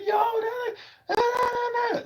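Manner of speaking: very high, pointing excitedly; mock excited caller. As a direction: imitate someone spotting him. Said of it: "They be like".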